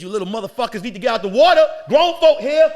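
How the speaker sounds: put-on loud, high shout at the kids in the pool; mock bossy shout. Second comic ordering kids out of the pool, loud shout. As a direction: bark the kids out of the water. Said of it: "He says".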